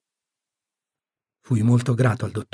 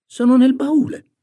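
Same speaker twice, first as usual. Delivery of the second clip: put-on muttering boy; 13 semitones higher, 5 dB louder than usual. A narrator's reading. Tom owns up about letters to aunt, high sulky boy. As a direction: admit it in a high boy's voice. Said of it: "borbottò Tom".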